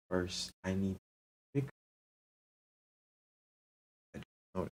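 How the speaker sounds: ordinary delivery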